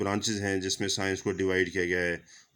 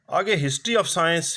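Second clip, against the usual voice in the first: slowed right down and a little louder, each word spaced out; slow heading announcement; 7 semitones higher, 4 dB louder, much slower than usual. Real, not acted. Teacher announcing the next topic to students, slow and emphatic. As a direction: announce the new topic like a chapter title, drawn out.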